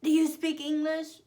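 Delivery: high, loud mimicked question; imitated rude, loud questioning; rude stranger. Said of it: "Oh, that's rude".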